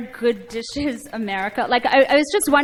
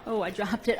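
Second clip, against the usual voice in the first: normal talking voice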